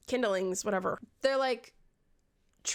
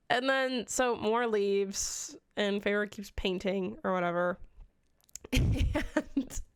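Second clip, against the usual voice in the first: ordinary talk, nothing marked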